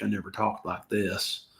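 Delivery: slow, drawn-out Southern accent on "I never talked like this". Shown as a demonstration. Vlogger showing viewers his Southern accent, slow and drawn out. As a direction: lay on a Southern accent, slowly. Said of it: "I wasn't trying to pull a Southern accent".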